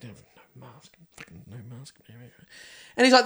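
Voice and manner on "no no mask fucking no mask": whispered, voiceless mutter under his breath. Retold or imitated. Chicken shop worker mutters at maskless customer, whispering. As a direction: grumble it almost silently to yourself. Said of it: "he kind of mutters".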